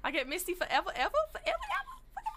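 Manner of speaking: loud, giddy voice rising into a squeak; gleeful squealing. Acted. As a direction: let the pitch run away upward.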